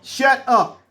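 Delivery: loud high snap; sharp command. Retold or imitated. Retold scolding the panicking man.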